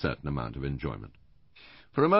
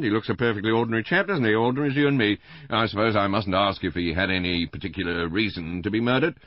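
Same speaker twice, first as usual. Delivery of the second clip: high, loud, rambling householder; Mister Bland, chattering; 7 semitones higher, 9 dB louder, faster than usual. A narrator's reading. Husband gossiping to the inspector about the murder, chattering loudly. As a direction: rattle on excitedly in a raised voice.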